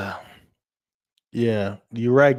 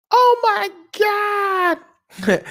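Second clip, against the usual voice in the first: shocked exclamation. Real, not acted. Vlogger reacting to the loss, high shocked cry. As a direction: cry out in disbelief. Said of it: "own reaction".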